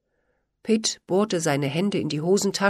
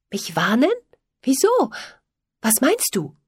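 wary, questioning, pitch jumping up on Wieso; eyeing him with mistrust; suspicious; 7 semitones higher, 3 dB louder than usual. A narrator's reading. Polly questions Pitt warily, suspicious of his warning. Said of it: "erwiderte Polly argwöhnisch".

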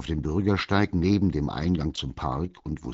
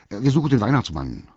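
fast, higher and louder blurt; rushed, eager answer; quickly; 6 semitones higher, 8 dB louder, faster than usual. A narrator's reading. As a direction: hurry the explanation out. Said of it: "erklärte Walter schnell".

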